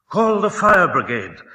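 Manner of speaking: loud, high call for help; frantic cry. A narrator's reading. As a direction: yell over the gong for the fire brigade. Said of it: "cried Missus Prothero as she beat the gong".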